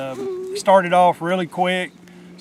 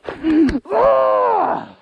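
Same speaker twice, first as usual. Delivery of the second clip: a high, loud burst of laughter; excited laugh; 4 dB louder than usual. Real, not acted. To his partner over the downed buck, a high joyful laugh. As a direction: laugh out loud with relief and joy.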